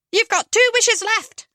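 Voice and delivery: fluting fairy godmother. Acted.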